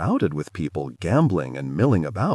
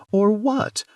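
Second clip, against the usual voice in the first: much higher innocent ingenue voice; 13 semitones higher than usual. Acted. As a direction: play the wide-eyed innocent. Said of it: "in my best ingenue voice".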